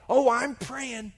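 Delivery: put-on high, slow elderly lady's voice; old woman. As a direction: imitate a very old lady answering sweetly. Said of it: "Mary's ninety-eight years old".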